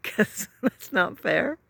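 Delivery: mock wounded protest with a laugh; whiny protest. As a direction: complain like a sulky child caught out. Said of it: "I would have said".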